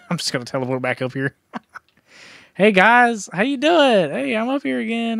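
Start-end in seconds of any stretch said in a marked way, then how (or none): 2.6-5.2 taunting. Real, not acted. Teasing zombies from up high.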